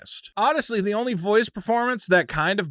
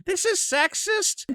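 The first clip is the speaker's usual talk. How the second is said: high, slow mock outraged reviewer; mock reviewer quote; 13 semitones higher, slower than usual. Mocking a reviewer calling the movie sexist, high and slow. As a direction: drawl it like a scandalized critic.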